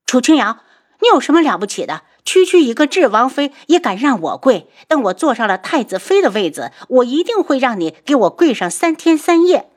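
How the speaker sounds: high, loud, fast furious outburst. Acted. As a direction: scream your fury at your sister. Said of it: "随后大怒". (大怒地尖声叫嚷)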